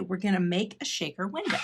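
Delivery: ordinary talk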